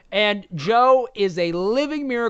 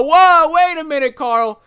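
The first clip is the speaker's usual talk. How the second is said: mock surprise; 10 semitones higher, 8 dB louder, slower than usual. Mock alarm at chat plug.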